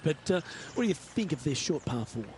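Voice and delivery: ordinary talk, nothing marked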